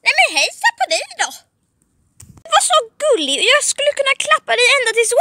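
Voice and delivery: high, swooping, gushing coo; cooing baby talk. Acted. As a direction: gush quickly over a cute pet. Coos at the cute demon. (gulligullande med ljus, sjungande röst)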